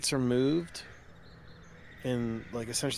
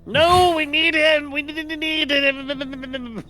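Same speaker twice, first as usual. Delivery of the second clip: high pleading voice; 15 dB louder, slower than usual. Acted. Character pleads with inner voice.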